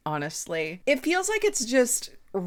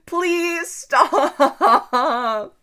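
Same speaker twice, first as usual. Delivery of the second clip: laughing plea; 8 semitones higher, 5 dB louder than usual. Real, not acted. Laughing at the book's bad idea.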